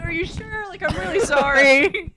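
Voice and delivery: hammed-up guilty plea laughing; laughing apology. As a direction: overdo the guilt while cracking up.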